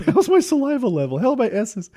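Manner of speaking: high-pitched, hammed-up worry; mock self-conscious. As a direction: fret in a high, comic voice. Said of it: "I'm wildly conscious of both things".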